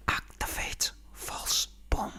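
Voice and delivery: slow breathy whisper. Shown as a demonstration. Comedian replaying his favourite gag, whispered slow. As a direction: savour it secretly. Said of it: "repeats the gag".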